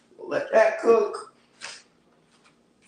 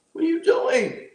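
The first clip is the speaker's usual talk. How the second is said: incredulous high question; surprised what; 7 semitones higher than usual. Real, not acted. Savoring food, surprised high exclamation. Reacting to the salt's taste.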